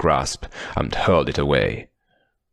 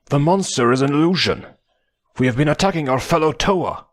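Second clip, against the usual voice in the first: urgent shout; 9 semitones higher than usual. Acted. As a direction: shout the warning to everyone. Pohatu warning others.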